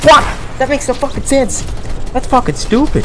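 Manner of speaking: high, exasperated complaint; frustrated outburst. Real, not acted. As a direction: vent it in disbelief. Frustrated gamer after losing.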